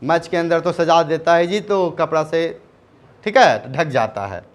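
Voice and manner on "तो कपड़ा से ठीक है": ordinary talk, nothing marked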